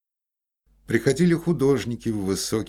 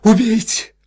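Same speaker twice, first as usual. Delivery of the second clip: loud hysterical cry, much higher; shouting; 14 dB louder than usual. A narrator's reading. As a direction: scream it at him. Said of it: "крикнула Ольга Ивановна".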